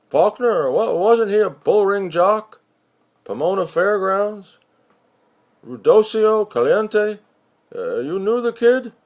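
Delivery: puzzled neighbour groping for the name; surprised roomie. Acted. Narrator voices roomie, surprised.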